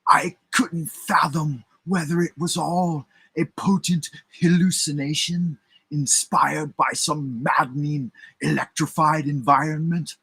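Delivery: haunted witness voice. Acted. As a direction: speak as the stunned witness. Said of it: "He went on to add".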